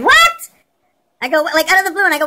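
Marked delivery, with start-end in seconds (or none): 0.0-0.3 high, loud shocked yelp; a sudden high cry of surprise; startled what. Retold or imitated. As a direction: jolt up in disbelief. Startled reply to mother.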